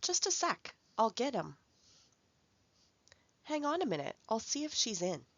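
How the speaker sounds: casual phone answer; casual informal phone reply. Shown as a demonstration. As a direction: toss it off lightly like to a friend.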